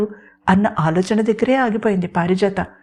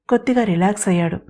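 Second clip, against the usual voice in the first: plain reading voice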